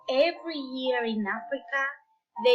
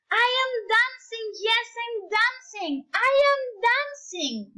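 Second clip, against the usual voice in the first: joyful cry; 10 semitones higher than usual. Acted. Gerald finally dances under the moon, high joyful cry. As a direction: burst out with joy. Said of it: "Gerald cried".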